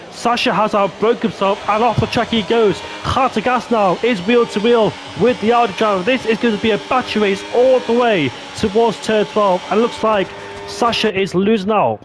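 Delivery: high-pitched live race commentary; excited play-by-play. Real, not acted. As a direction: call the wheel-to-wheel action with urgency.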